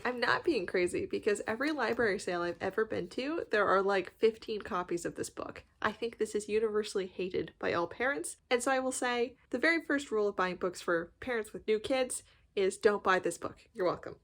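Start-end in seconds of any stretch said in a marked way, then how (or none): none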